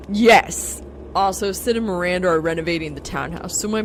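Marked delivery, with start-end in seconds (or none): 0.1-0.8 loud, higher victorious yes; triumphant yes. Acted. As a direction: bark it out in triumph. Answering own boast triumphantly.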